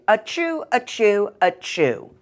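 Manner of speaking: three hammed-up sneezes; mock sneezing; fake sneezes. Wolf fakes sneezing.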